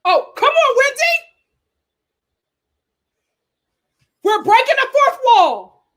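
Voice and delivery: high, loud cheering at the screen; hyped cheering. Real, not acted. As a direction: egg the cast on. Hyped reactions to the show.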